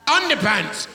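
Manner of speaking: high loud outraged cry; indignant shout; shout underpants. Acted. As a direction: yell it like a shocked survivor. PE punishment recalled.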